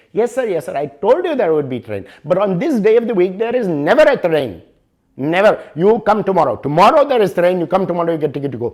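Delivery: the Indian English clerk insists there is never a train on this day; Indian English accent. Retold or imitated.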